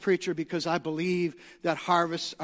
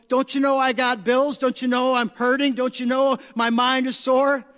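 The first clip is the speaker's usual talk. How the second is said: mock pleading believer; 6 semitones higher, 9 dB louder than usual. Voicing a worried believer.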